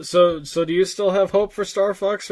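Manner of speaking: ordinary talk, nothing marked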